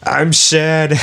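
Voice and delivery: high, slow, mopey put-on Trump voice; sad Trump impression. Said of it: "I can't fucking do the impression".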